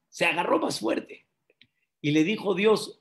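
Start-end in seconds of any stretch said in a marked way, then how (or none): 0.1-1.3 higher-pitched storytelling repeat; emphatic repeat. A narrator's reading. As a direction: raise the pitch to underline the twist. Punchline repeated with raised pitch. (repetición enfática en tono agudo)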